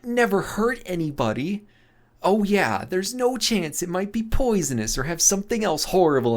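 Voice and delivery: high, fast, sarcastic outrage; incredulous anger. Acted. Loxa mocking Emil's careless mushroom claim, outraged and high. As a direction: throw his words back at him, furious. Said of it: "Loxa stammers".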